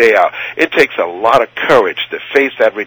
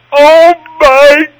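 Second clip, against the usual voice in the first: slow, high, drawn-out "Oh, my God"; stunned gasp; 14 semitones higher, 6 dB louder, much slower than usual. Retold or imitated. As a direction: let it out in awe and dread, much higher and louder. Sees eighty-two thousand people.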